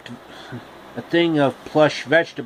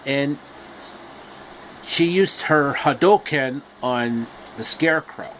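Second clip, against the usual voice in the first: ordinary delivery, nothing marked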